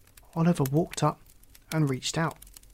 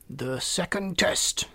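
slow, higher ceremonial croak; toad's announcement; 4 semitones higher, slower than usual. A narrator's reading. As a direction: announce the next trial with slow pomp. Toad announces the next test.

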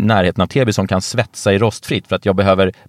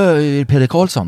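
higher, hesitant fan voice; mock fan asking; 5 semitones higher than usual. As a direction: imitate a shy fan asking. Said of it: "Och så bara". (lätt spelad blyg beundrare)

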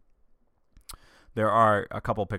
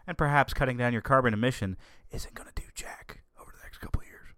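hushed conspiratorial whisper; secretive aside to viewers; 4 dB quieter, faster than usual. Real, not acted. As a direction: lean in and whisper the unpopular opinion. Conspiratorial aside about carbon.

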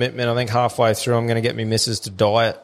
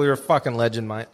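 ordinary delivery

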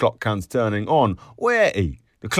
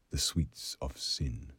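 whispering; 5 semitones lower, 13 dB quieter than usual. A narrator's reading. Whispered inner thought.

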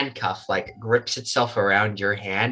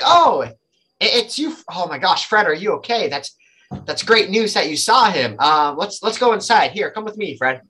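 higher, startled start, then urging; surprised relief; 7 semitones higher than usual. Acted. Jesse spots the butler and asks if he is okay, surprised relief. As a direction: gasp of recognition, then anxious fussing. Jesse recognizes and frets over Fred.

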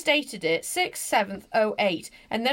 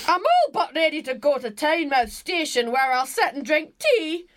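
put-on loud, higher Glasgow accent, reading out; granddad's Glasgow accent; 4 semitones higher, 4 dB louder than usual. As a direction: read it as a Glaswegian granddad. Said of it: "do it in his accent".

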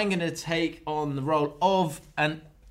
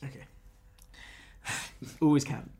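ordinary delivery

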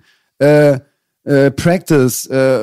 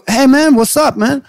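imitated friendly greeting; 8 semitones higher, slower than usual. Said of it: "Er so".

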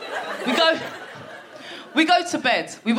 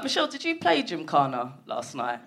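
quiet pointed question; 9 semitones lower, 7 dB quieter than usual. Retold or imitated. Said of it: "she was like".

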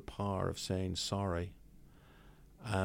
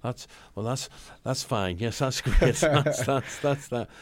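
louder, higher, laughing and stammering; flustered laughing; 4 semitones higher, 9 dB louder, slower than usual. Retold or imitated. [name] accepting George's apology, laughing and flustered. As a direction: cave in, laughing through embarrassment. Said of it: "And I said".

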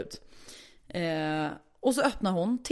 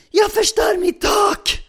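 very high, slow, loud shriek; shrieking neighbour; 12 semitones higher, 9 dB louder, much slower than usual. Retold or imitated. Neighbour in quarrel over her ceiling, shrieking.

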